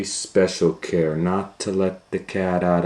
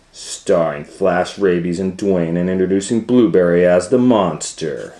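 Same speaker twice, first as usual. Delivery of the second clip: bold movie trailer voice, building louder; trailer announcer; 6 dB louder than usual. Acted. Parent announcing the movie cast to Leo, trailer style. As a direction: roll the credits like a big film trailer.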